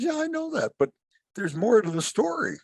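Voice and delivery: very high, lightly comic frog voice; frog character. Acted. Frog answering the man's warning, high frog voice. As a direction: squeak up into a cartoonish frog reply. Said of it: "The frog says".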